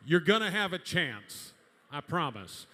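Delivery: ordinary talk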